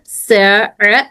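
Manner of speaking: usual speaking voice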